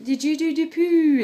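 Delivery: put-on a big silly question to the baby; baby talk. Shown as a demonstration. Mum hearing her baby fart, loud high baby talk. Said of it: "when you hear them farting away".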